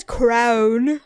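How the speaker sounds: "crown" loud and drawn out, crow-oon; CRAYON as crown. Retold or imitated. Demonstrating her partner's pronunciation, "crown" drawn out. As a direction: exaggerate the vowel in "crown". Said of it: "how does he say crown?".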